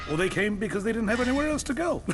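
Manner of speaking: high, rushed, gleeful climb; manic excitement. Acted. Conrad to a newcomer about the prisoners, manic and rushed. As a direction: let it race upward in pitch.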